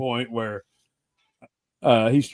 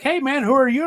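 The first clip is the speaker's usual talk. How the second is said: higher-pitched friendly quote; light voice of himself greeting a stranger; greeting a band singer, lightly voiced; retold greeting; 10 semitones higher than usual. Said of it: "I'm like".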